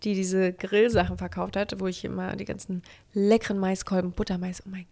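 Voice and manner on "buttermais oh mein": soft dreamy whisper; whispered craving. Real, not acted. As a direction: breathe the word with longing.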